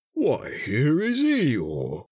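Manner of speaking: very slow, high, drawn-out greeting; delighted surprise. A narrator's reading. Pooh greeting Eeyore after the hug, delighted surprise. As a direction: draw it out in pleased discovery.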